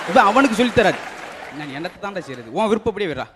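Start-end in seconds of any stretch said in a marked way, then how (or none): 1.6-3.3 low, very soft resigned reply; worn-down father, voice dropped low; resigned giving up. Acted. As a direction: give up softly, leave it to them. Exasperated father gives up.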